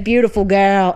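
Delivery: imitating an Australian farmer's compliment; Australian accent. Retelling farmer friend's morning greeting, imitated Australian accent. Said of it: "he was this morning, he's like".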